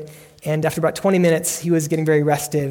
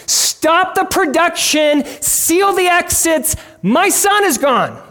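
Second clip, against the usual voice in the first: high, slow, loud cry of alarm; acted panicked shout; 11 semitones higher, 5 dB louder, slower than usual. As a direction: shout it out slowly as a desperate parent. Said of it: "on the verge of shouting at the top of".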